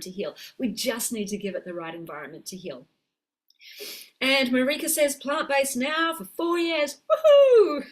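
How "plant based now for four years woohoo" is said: high, loud, delighted whoop; excited cheer. Real, not acted. Coach cheering a viewer's four plant-based years, loud delighted whoop. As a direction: cheer her on with a laughing woohoo.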